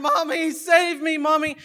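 high-pitched, hammed-up desperate plea; mock crying out. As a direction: cry out like you mean it, playfully overdone. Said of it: "so Bill starts crying".

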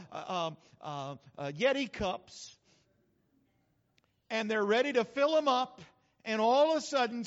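ordinary talk

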